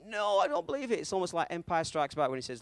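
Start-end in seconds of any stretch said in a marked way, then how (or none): 0.1-1.0 high-pitched mock disbelief; acted disbelief. Young viewer reacting to the film's ending, acted disbelief. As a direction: refuse to believe it, pitched up.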